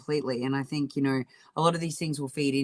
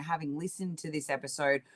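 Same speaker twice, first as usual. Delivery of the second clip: ordinary talk, nothing marked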